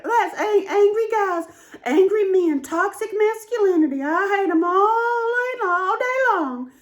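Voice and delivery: high, loud, mock-tearful griping; mock rant. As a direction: ham up a self-righteous complaint. Mocking her old self's rant.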